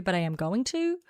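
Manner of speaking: ordinary talk, nothing marked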